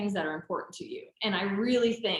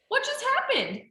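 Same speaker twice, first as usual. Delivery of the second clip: much higher, amused disbelief; mock stunned. At the restaurant, Casey orders in Spanish, stunned. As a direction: squeak it out in amazement.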